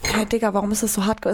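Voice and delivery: ordinary talk, nothing marked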